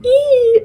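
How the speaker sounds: high shivery eee; shivering squeal. Retold or imitated. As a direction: squeak like you're freezing. Drawing of Ellie shivering.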